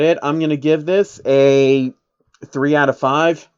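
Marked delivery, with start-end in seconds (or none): none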